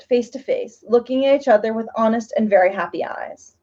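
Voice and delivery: ordinary delivery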